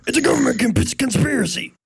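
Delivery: high, mock-paranoid conspiracy voice; mock conspiracy nut. Mocking conspiracy talk.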